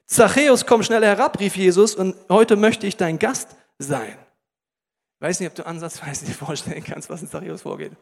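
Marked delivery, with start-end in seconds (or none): 0.0-4.2 loud, measured Bible reading; solemn scripture read to the congregation. Acted. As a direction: read the passage like a proclamation. Bible passage read aloud.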